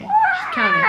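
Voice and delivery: high, loud breaking voice; real tears break through; crying. Real, not acted. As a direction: voice cracks with tears. Baby not breathing, retold panic.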